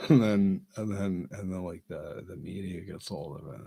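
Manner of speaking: normal talking voice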